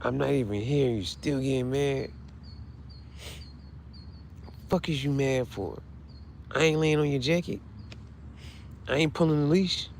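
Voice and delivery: soft dog voice. Pretend. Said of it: "She said".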